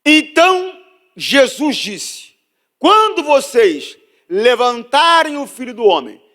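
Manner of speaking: loud, high, drawn-out preacher's reading; scripture proclaimed. Real, not acted. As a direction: proclaim each phrase at full force, with long pauses. (proclamação bíblica em voz alta e arrastada)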